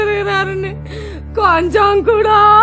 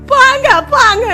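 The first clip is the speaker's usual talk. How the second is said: loud sobbing cry, pitched up; sobbing wail; 13 semitones higher, 15 dB louder than usual. Acted. Mother sobs loudly at her husband, crying wail. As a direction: break into a loud sob.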